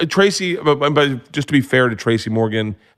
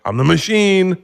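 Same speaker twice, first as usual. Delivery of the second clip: high, emphatic group echo; mock cheering boast; 8 semitones higher than usual. Imitating Russians throwing his phrase back, high and excited. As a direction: pitch it up like a party chant.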